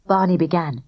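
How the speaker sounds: ordinary delivery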